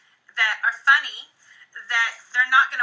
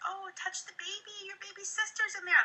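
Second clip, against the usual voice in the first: put-on higher, soft voice for a small child; talking to a toddler; 8 semitones higher, 9 dB quieter than usual. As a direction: gently coax the toddler. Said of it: "you can't really say".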